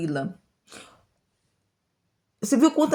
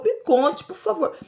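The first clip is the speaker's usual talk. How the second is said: pleading; 7 semitones higher than usual. Retold or imitated. Sansão imitado implorando.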